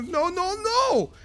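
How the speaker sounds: rising high, loud protesting cries; anguished no. Real, not acted. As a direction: plead no as the hero dies. Caster watching a hero die.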